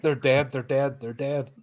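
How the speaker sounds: understated, unpanicked murmur of the same words; muted repeating. Acted. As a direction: repeat it numbly, trailing away. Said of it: "So I'm just like".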